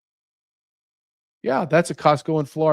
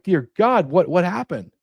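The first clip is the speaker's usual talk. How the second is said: high-pitched, appalled astonishment; bewildered, pitched well up; stunned disbelief; 8 semitones higher than usual. Acted. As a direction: act out a newcomer's shock, voice climbing. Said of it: "you're just like".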